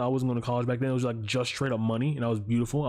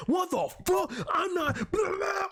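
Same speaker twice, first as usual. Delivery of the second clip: high, shouted mock outburst; retold customer yell; slower than usual. Customer yelling at the clerk over no Saturday shipping, high shouted outrage. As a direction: fire off an indignant yell, pitched way up. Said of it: "yelling and just going off".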